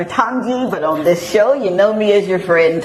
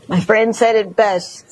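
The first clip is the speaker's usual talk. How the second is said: ordinary talk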